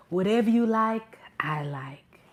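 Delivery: soft, whispered submission; hushed, breath-only obedient reply; movie-quote impression. Doting suitor quoting Coming to America, hushed and submissive. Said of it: "coming to the America".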